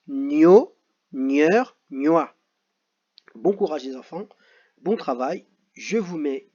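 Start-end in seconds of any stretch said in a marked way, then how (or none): 0.1-2.4 gno, gneur, gnois each said loud and distinct; clear sound demo. Shown as a demonstration. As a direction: present each sound crisply. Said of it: "Les sons qu'on vous propose". (gno, gneur, gnois articulés fort)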